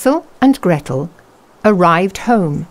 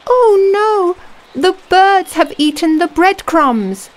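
dismayed gasp; 12 semitones higher than usual. Acted. Birds ate breadcrumbs.

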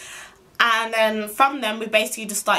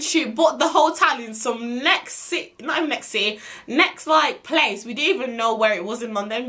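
ordinary talk, nothing marked

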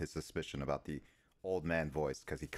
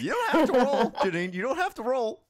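higher and louder, laughing through the words; laughing protest; 9 dB louder than usual. Real, not acted. Laughing at Janine, telling her she needn't roll. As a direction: burst out laughing as you tease.

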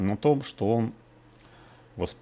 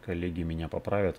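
usual speaking voice

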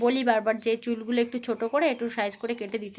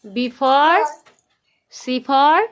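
put-on bright, loud sing-song quizzing of a toddler; loud child-directed teaching; 4 semitones higher, 7 dB louder than usual. Mother quizzing her toddler on letters, in a loud sing-song. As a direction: coax the little one through letters and numbers, loud and eager.

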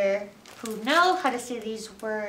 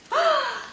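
surprised gasp; 13 semitones higher than usual. Acted. Surprised at festival page.

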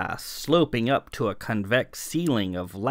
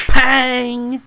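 loud pang; 13 semitones higher, 12 dB louder than usual. A narrator's reading. Narrator voicing the bowl hit.

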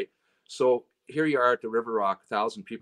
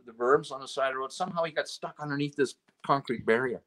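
ordinary delivery

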